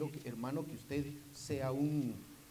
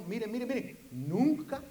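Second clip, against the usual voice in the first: nunca rises high and bursts out loud; preacher's cry; 7 semitones higher, 4 dB louder, slower than usual. Real, not acted. Preacher to the congregation, warning that the fire never goes out, loud high nunca. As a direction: shout the word nunca up over the congregation.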